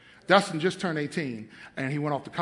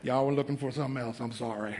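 softer, lower aside; quiet apology; 7 semitones lower, 10 dB quieter, faster than usual. Real, not acted. Preacher apologizing to the congregation, softer and lower. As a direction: mutter a joking apology. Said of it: "Y'all came on the wrong night".

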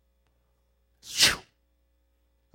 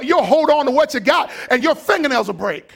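ordinary delivery

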